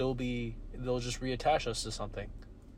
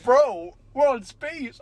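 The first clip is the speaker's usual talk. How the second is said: mocking future dude; 12 semitones higher, 11 dB louder, slower than usual.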